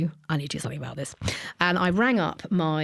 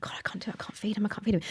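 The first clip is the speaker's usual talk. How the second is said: whispered, panicked self-talk; desperate whisper; 3 semitones higher, 7 dB quieter, much faster than usual. Retold or imitated. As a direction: breathe the words out in despair. Desperate retold attempt to feed baby.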